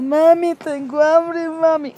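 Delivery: put-on a small kid whining to mom for food; crying child. Retelling the hungry child begging mami loudly.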